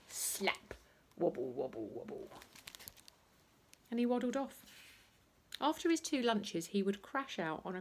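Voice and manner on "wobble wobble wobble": higher sing-song wobble, fading; wobbly belly noise. Acted. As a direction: make the belly wobble with your voice.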